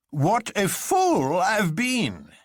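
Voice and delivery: high, slow self-reproaching exclamation; exclaiming. A narrator's reading. Colonel realizing his mistake, exclaiming high. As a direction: cry out at your own blindness. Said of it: "exclaimed the Colonel".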